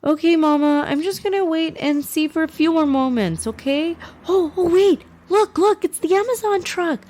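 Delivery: put-on high excited little boy voice; excited young boy. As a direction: squeal it like an eager kid. Voices child Yusuf.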